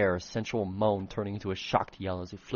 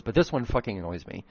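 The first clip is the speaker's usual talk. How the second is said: ordinary delivery, nothing marked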